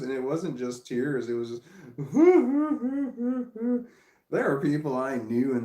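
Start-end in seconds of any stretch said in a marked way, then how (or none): 1.7-3.9 high burst of laughter. Real, not acted. Laughing at daughter's tears.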